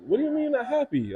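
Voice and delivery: puzzled, rushing the question; surprised wife. Retold or imitated. Wife questioning his unhappiness, surprised and quick. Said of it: "she was like".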